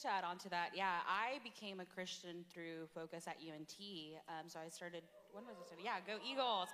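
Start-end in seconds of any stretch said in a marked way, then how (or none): none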